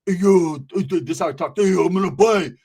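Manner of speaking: imitated Uso accent, halting start. Uso customer placing an order, imitated Uso accent. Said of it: "he had that little accent".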